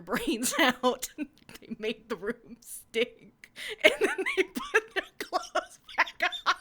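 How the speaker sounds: high loud laughter through the words; laughing. Real, not acted. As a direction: crack up while saying it. Cracking up at her own text.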